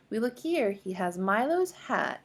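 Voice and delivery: usual speaking voice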